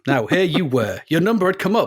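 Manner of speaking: hammed-up American racer's narration, somewhere in America; American accent. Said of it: "it's somewhere in America".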